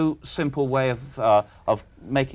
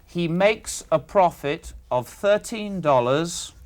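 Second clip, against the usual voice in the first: slow, higher, spaced-out phrases; slow dictation; 4 semitones higher, slower than usual. Real, not acted. Questioner dictating the profit in the sum, slowly. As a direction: lay out each part for the listener to note. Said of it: "I'll say it slowly".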